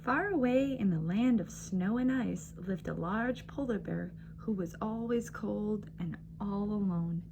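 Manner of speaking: ordinary delivery, nothing marked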